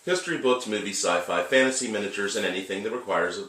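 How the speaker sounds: brisk radio host read; podcast host intro. Acted. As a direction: announce the show like a host.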